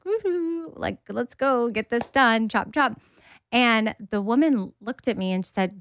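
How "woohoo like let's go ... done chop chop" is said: high, light woohoo easing down; easy breezy. Retold or imitated. Patient arriving with coffee for a scan, easy breezy. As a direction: imitate a breezy, carefree little cheer. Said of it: "easy breezy with my coffee".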